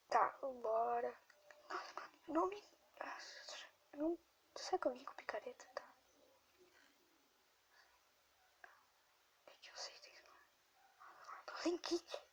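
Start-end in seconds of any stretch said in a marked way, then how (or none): 4.0-12.2 whispering. Real, not acted. Whispers while grandma sleeps.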